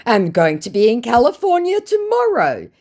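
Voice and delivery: proud crooner voice; boasting scammer. Retold or imitated. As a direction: fake singer bragging about his tour. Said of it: "he said".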